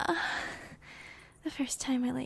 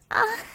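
sharp high cry of pleasure; loud climaxing moan; 10 semitones higher, 10 dB louder than usual. Acted. Escalating moans.

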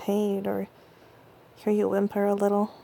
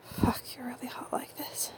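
much softer whisper; 2 semitones higher, 10 dB quieter than usual. Acted. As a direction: let it slip out as a hushed whisper. Intimate roleplay, aroused teasing.

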